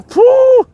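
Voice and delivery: high, loud "phew"; whoop. Real, not acted. Angler starting his fishing session, high whoop. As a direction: let out a high, loud "whoo". Whoops while setting up to fish.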